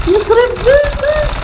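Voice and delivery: exasperated. Real, not acted. Exasperated at the game.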